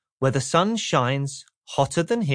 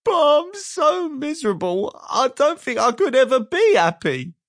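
high, louder, woeful squeak; miserable squeak; 11 semitones higher, 4 dB louder than usual. Acted. Mister Miserable despairs to Mister Happy, miserable squeak. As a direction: wail your hopelessness. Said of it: "he added miserably".

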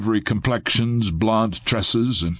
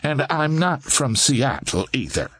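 higher, prim lady's voice; admonishing lady; 5 semitones higher than usual. A narrator's reading. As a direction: correct him primly, as a portly lady. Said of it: "the portly lady admonished".

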